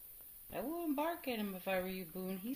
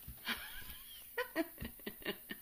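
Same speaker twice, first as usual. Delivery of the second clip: laugh. Real, not acted. Laughing at dogs.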